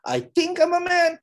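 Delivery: a wobbly unsure claim, played for laughs; I think said high and doubtful; unsure mock. Shown as a demonstration. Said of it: "Would you be confident with him?".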